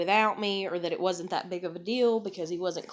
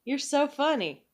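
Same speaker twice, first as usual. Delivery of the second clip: sarcastic mockery; 7 semitones higher than usual. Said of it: "And I'm just like".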